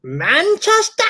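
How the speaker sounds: slow, high-pitched puzzled Manchester; drawn-out baffled query; puzzled question. Retold or imitated. Woman at a party puzzled by his hometown, slow high query. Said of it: "And she's like".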